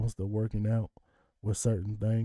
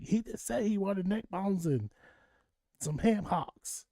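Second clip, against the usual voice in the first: annoyed ex-wife; 9 semitones higher, 3 dB quieter than usual. Retold or imitated. Ex-wife mad, repeats his order.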